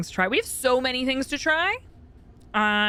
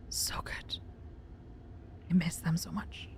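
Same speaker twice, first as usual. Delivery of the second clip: longing whisper, almost no voice; hushed wistful whisper; 5 semitones lower, 13 dB quieter than usual. Real, not acted. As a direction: breathe it out like a secret craving. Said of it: "wistful whisper about burritos".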